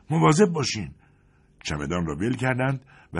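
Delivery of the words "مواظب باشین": high, loud cry of alarm; warning shout. Acted. Feinhals warning the two men of the shell, loud shout. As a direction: yell the warning as the shell comes. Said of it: "فریاد زد".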